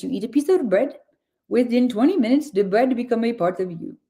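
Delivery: put-on light Indian accent, quoting Sadhguru. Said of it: "How is my Indian accent?".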